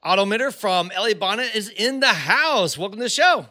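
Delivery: high, loud podcast welcome; upbeat host opening the interview; show host intro. Acted. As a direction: introduce the guest with big, bouncy energy.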